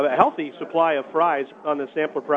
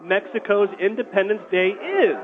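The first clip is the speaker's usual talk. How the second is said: radio announcer; 4 semitones higher than usual. Acted. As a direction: pitch the contest to listeners. Radio contest call to action.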